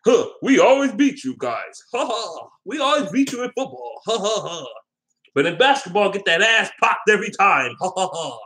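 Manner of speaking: high-pitched mocking taunt with fake haughty laughs; put-on high voice, crowing ha-ha-ha; cocky fans. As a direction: gloat like an obnoxious sports fan. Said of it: "They're cocky because the football team".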